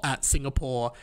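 usual speaking voice

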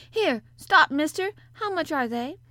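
high, loud shout to stop someone; sudden call. A narrator's reading. Mother stops the pedlar to ask a price, calling out. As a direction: call out urgently to a passing stranger. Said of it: "she calls out quite sudden".

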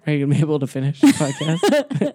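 laughing at her being starstruck; laughing tease. Real, not acted. Teasing through laughter.

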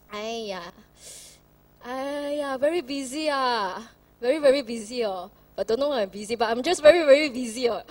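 Singlish excuse, loud and dismissive. Retold or imitated. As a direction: brush it off in Singlish, aiya. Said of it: "but Pastor Michael say".

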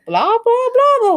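loud, high mock read-aloud babble; high-pitched droning parody of reading; boy reading aloud. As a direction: mimic a child blaring out every word. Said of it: "hearing Odin reading aloud".

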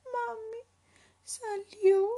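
put-on high-pitched, sorrowful child voice; sad, high little voice; sad little leopard. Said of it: "susurró Leopardito con tristeza".

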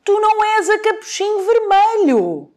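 high, sharp wolf cry. Acted. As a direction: call out as the big bad wolf.

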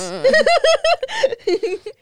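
loud high-pitched laughter. Real, not acted. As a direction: crack up laughing. Laughing at broskies.

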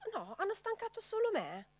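high, ironic mock protest. Preacher teasing the congregation, high mock protest.

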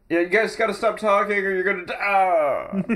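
high mock warning. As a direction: warn them in a high mock voice. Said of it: "He's like".

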